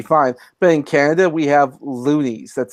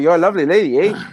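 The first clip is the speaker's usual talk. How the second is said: polite Canadian; 2 semitones higher than usual. Acted. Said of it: "me being Canadian, uh, I'd be going".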